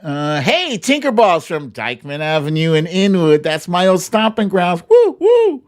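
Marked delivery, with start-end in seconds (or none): 4.9-5.6 woo woo up high and loud; high excited whoop. Real, not acted. Host whoops for a viewer from the old neighborhood, high and loud.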